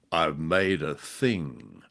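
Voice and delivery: ordinary talk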